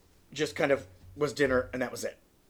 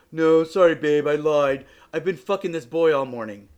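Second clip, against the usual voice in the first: loud confession; 8 dB louder than usual. Retold or imitated. Boyfriend confesses cheating, loudly.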